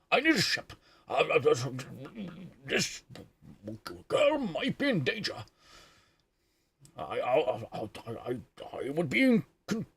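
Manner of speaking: halting, stammering old captain impression; Picard. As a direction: stammer through it as a hammed-up starship captain. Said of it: "the birth of the Picard impression".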